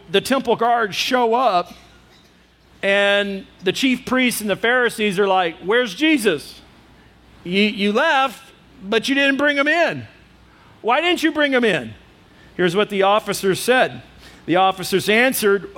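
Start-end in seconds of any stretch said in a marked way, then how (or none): 5.6-11.9 higher-pitched, mock-indignant questioning; demanding questioning. Chief priests to empty-handed temple guards, demanding questioning. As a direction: press them for an answer, pitch climbing.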